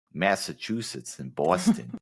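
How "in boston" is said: put-on Boston accent, pitched up high; playful Boston accent; mock Boston accent. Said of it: "They talk like this".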